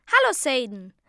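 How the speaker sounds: loud cheerful hello; brother's greeting. A narrator's reading. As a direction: greet a friend brightly. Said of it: "sagte mein Bruder".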